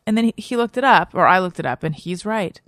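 normal talking voice

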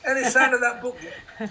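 a cruel father nagging his son about the unwritten book. Retold or imitated. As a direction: needle him like a cruel dad. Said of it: "my father, who was much crueler".

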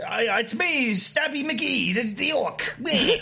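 high, stretched-out chummy orc; comic orc introducing himself with a flourish; Stabby McGee. Acted. Orc turning up as the unwanted sidekick, mock-lovable. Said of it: "But no, we get".

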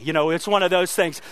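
ordinary talk

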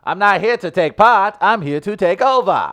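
loud, high Irish boast; Irish accent. Acted. Quoting Conor McGregor's boast, loud Irish accent. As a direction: declare it big in an Irish accent. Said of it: "Irish accent".

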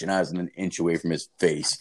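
ordinary talk, nothing marked

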